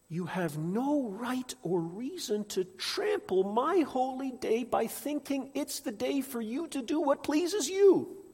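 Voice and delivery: high, loud impassioned preaching; preaching at full force. Acted. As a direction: preach it with rising fire. Preaching God's words.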